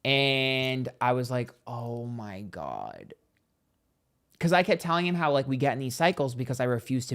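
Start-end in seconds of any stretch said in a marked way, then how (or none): 1.7-3.2 slow, hushed, drawn-out amazement; stunned realisation. Retold or imitated. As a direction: stretch every word out softly, as if mind-blown. Acted revelation in retold story.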